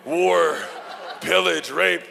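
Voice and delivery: loud, high mock battle chant; comic chanted shouts, punched one by one; ancestors' war cry. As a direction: call out each word like a warrior rallying. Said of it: "all of my ancestors, and they're just, like, coming in going".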